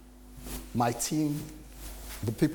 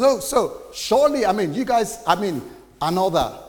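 hopeful boss; 7 semitones higher, 6 dB louder than usual. Retold or imitated. Boss hopes the job is finished.

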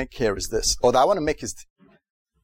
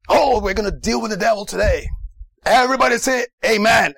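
raised, forceful American preacher voice; shouting preacher; 5 semitones higher, 3 dB louder than usual. Retold or imitated. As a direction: imitate a preacher working up a crowd. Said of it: "this guy can really shout".

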